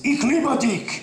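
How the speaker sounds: high, slow mock-harsh "Ich liebe dich"; harsh German. Shown as a demonstration. Comic mocking German romance, high and slow. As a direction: spit out "Ich liebe dich" slowly, like an order. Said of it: "In Germany, it's".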